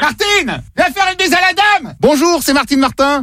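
high put-on wife, lightly voiced. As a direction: call the wife over, then greet as her. Calls, then voices the wife.

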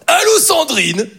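high, slow, sing-song TV host voice; high sing-song TV host on the phone; Jean-Luc Reichmann impression. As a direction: croon it like a game-show host. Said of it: "faire Jean-Luc Reichmann".

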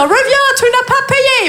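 put-on high call in English-accented French; appel à l'accent anglais. Rappelle le mec parti, accent continué. (appel suraigu avec accent anglais)

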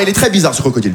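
ordinary delivery

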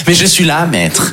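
high-pitched fawning servant, dropping on the last word; servile little creature. Acted. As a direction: pipe up like a lowly house-elf. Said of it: "Vraiment une petite créature".

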